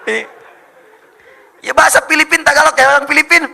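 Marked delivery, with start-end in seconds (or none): 1.6-3.4 high, very loud announcement; rousing shout. Real, not acted. Preacher announcing Tagalog to the crowd, high loud shout. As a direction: belt it out to the hall.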